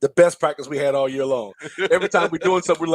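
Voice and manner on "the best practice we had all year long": usual speaking voice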